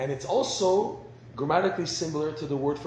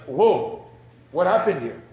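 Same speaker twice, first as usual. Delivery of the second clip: startled whoa; 9 dB louder than usual. Retold or imitated. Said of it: "And he's like".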